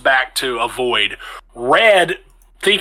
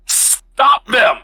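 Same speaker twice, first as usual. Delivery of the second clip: high-pitched command shouted across a fight; urgent yell; 12 semitones higher, 3 dB louder than usual. Acted. Adrian calls to Rasmus as two druids charge, urgent yell. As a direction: yell it out as an order, much higher. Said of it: "just generally yells".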